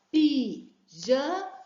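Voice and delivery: "Pijama" split into slow syllables, pi-ja-ma. Shown as a demonstration. Teacher showing children how to count syllables, slow and split. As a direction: clap out "Pijama" one syllable at a time. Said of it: "Vai contando no dedinho assim".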